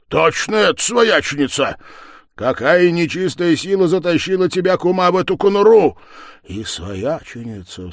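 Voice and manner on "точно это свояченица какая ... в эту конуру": high, loud astonishment; amazed exclamation. Acted. Man finds his kinswoman locked up, loud and astonished. Amazed at finding the sister-in-law.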